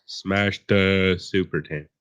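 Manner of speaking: "Smash the super tin" said very slowly; his own way. Shown as a demonstration. Showing a friend his real pronunciation, very slow. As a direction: slowly show how he says it. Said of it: "as I say".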